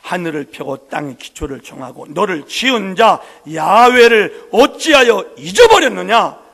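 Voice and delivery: loud, slow thundering rebuke; God's rebuke in a booming voice; voice of God rebuking. Acted. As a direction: boom out God's question to fearful people. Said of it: "이렇게 말씀을 하셔요".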